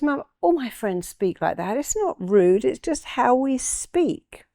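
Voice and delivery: lightly voiced daughter defending herself; imitating daughter. Daughter answering mum's criticism of her speech, lightly imitated protest. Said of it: "she goes".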